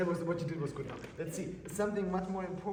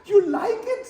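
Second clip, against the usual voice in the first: much higher, louder, laughing disbelief; outraged retort; 13 dB louder than usual. Retold or imitated. Retelling a reply to a conductor, loud laughing outrage. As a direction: fire back at the conductor, laughing. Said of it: "I said".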